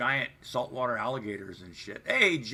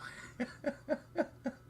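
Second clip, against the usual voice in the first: high wheezy chuckle; real laughter; 6 dB quieter than usual. Real, not acted. Streamer laughing at the mess he still lives in. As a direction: break into a helpless chuckle.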